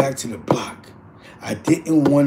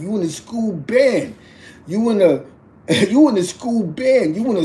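reassuring dad, acted; 6 semitones higher than usual. Dad consoling his crying daughter.

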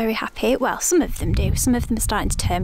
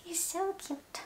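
high, soft coo at the cat; 8 semitones higher, 14 dB quieter, slower than usual. Real, not acted. Cooing at the pet, higher take.

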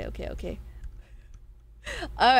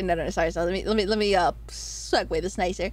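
ordinary delivery

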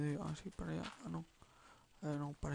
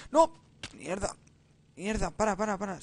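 panicked, louder and higher shouts; real alarm; 4 semitones higher, 9 dB louder than usual. Real, not acted. Player startled by a game glitch, alarmed shouting. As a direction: blurt it out in sudden panic.

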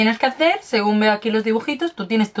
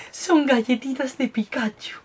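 whisper; 5 semitones higher, slower than usual. Real, not acted. Excited discovery of Pikachu snack.

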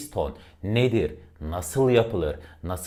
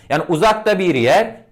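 uzakta lifted high and loud; high and far; 10 semitones higher, 9 dB louder than usual. Shown as a demonstration. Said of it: "Bakın tonlama yapmış oldum".

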